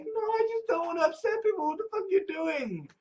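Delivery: very high, mock-desperate protest; high-pitched protest. Accused person answering back, high-pitched mock protest. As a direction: plead it way up high.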